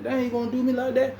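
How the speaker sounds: way up high, indignant disbelief; mock protest. Inmate refuses to go, high indignant squeal.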